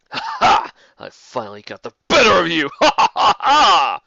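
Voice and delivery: loud, cackling triumphant taunt; gloating rival. Acted. Halter gloats after knocking away her sword, loud cackling laugh. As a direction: crow over the beaten foe.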